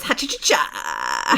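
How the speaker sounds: low, slow Car Fox chuckle. Acted. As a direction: growl out a goofy cartoon fox laugh. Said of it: "the Car Fox sounds like this".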